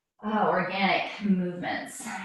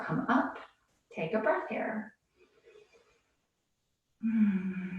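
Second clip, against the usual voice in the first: ordinary talk, nothing marked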